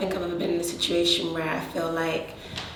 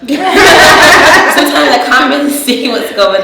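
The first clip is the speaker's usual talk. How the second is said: loud, high, slow laughing; 9 semitones higher, 20 dB louder, slower than usual. Real, not acted. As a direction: laugh at her own mistake. Laughing about her glasses.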